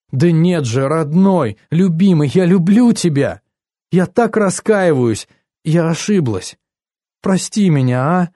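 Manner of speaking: gushing, insistent declaration of love; pleading apology. A narrator's reading. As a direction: beg forgiveness as if for a broken cup. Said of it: "спрашивала она таким тоном, будто разбила мою любимую чашку".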